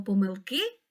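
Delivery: помилки stressed on ки, po-myl-KY; stress on last syllable. Shown as a demonstration. Teacher explaining single stress, last syllable of помилки. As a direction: land on the final syllable of помилки. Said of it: "падає на останній склад".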